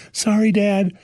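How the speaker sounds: lightly voiced young daughter apologizing. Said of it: "And Georgie's like".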